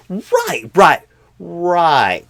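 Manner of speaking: loud, abrupt right, right, right; sudden loud recovery. Acted. Flustered interviewer loudly snaps back to the candidate. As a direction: blurt it out to cover the lapse.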